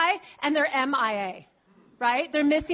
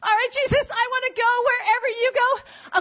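high, loud eager pledge; eager follower; 8 semitones higher, 4 dB louder than usual. Acted. Follower pledging to Jesus, high and loud. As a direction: gush your promise at him.

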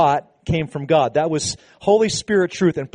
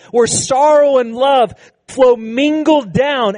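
slow, lifted and swelling preacher's cry; impassioned preaching; 7 semitones higher, 5 dB louder, slower than usual. Acted. Preacher lifting the church to the cross, slow and swelling. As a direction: pour it out slowly at full force.

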